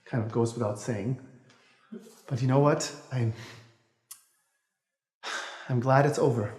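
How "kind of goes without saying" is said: usual speaking voice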